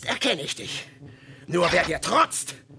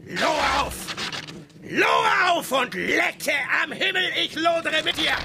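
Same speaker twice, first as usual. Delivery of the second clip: defiant shout. Acted. Ahab calls the fire higher, defiant ecstatic shout. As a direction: hurl it up at the sky.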